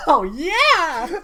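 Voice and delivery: loud, very high whoop of approval; delighted cheer. Real, not acted. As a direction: cheer the co-host's pick wildly. Cheering co-host's game pick.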